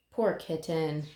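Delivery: ordinary delivery, nothing marked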